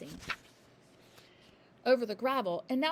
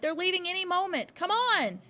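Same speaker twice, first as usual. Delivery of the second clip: high loud urgent call; shouting; 9 semitones higher, 8 dB louder than usual. A narrator's reading. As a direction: drag her teacher along. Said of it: "she shouted".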